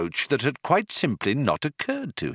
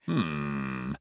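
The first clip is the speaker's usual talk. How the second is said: low, soft hum; musing; 7 semitones lower, 8 dB quieter than usual. A narrator's reading. Captain alone with his verses, musing. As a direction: murmur it to yourself. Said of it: "mused to himself".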